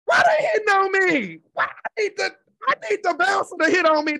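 high, loud exasperated shout. Retold or imitated. Complaining about the wrong men, high exasperated shout. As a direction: yell it in mock despair. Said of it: "I'm like".

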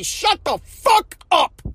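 slow, stretched, exasperated shut-up; dragged-out mock scolding, a touch of a cry in it; drawn-out blunt telling-off. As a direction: spell it out slowly like he's fed up. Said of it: "I would say".

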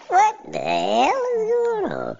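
baffled outcry. Real, not acted. Reacting to the video, =23. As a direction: throw your hands up in disbelief.

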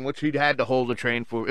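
ordinary delivery

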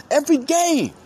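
hyped. Real, not acted. Hyping his team on podcast.

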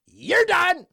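"you're" shot up high and loud, then "done" falls; yelled catchphrase. Acted. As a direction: scream "you're done" at full volume. Second, yelled take.

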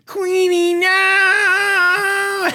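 very high, long-drawn imitation scream; retold desperate plea. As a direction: wail it like a man being dragged away. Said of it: "I'll never forget hearing".

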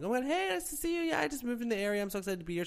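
high, soft excited chatter; gushing greeting. Retold or imitated. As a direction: greet a fan in a sweet rush, high and light. Acts out herself greeting a fan.

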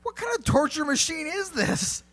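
high, squeaky outraged vampire; high put-on vampire crying out at the weapon. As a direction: voice the vampire shrieking in dismay. Vampire voiced in story.

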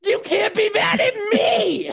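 high, drawn-out indignant cry; reenacted rage. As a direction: wail it in exaggerated outrage. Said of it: "I was just reenacting my rage".

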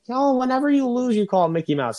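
high, loud mimicked jeer; mocking Frank. Host imagining absent Frank's reply, mocking and high. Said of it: "he'd be like".

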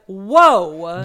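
loud, drawn-out exclamation of surprise; re-enacted stunned outburst; mock shock. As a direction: blurt it out big, stretching the vowel. Re-enacts own shocked reaction.

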